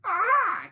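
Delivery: loud high "Ah!" cackle; sharp high delighted cackle. Acted. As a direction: let out a gleeful cackle. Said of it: "cackled the sandwich".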